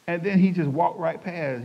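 ordinary talk

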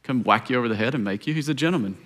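hushed, gentle preaching; intimate sermon. Acted.

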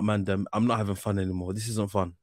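normal talking voice

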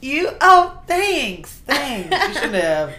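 high, hammed-up gushing thanks; fake gracious thanks. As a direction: play coy when the check comes.

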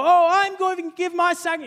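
high, haughty, pompous show-off voice; mocking rich man. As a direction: ham up a boastful rich giver. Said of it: "these rich people".

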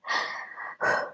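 soft, voiceless steadying exhale; steadying sigh. Real, not acted. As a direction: breathe out to compose yourself. Composing self after crying.

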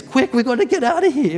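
hammed-up urgent call to flee; mock urgent alarm. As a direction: play at hurrying the family out. Acts out fleeing with dependents.

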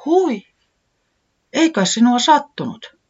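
high, worried little cry; a tiny friend fearing the bunny got hurt; trembling voice. A narrator's reading. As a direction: ask with a quiver of concern. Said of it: "kysyi Tiputi ääni värähtäen".

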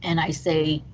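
normal talking voice

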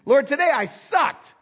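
high, slow mock-prayer confession; hammed-up admission of failure to God. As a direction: own up with exaggerated dismay. Voicing a believer's dejected confession.

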